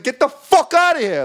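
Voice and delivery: loud, high angry yell; losing temper. Retold or imitated. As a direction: re-enact throwing a customer out. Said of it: "he was definitely losing his temper".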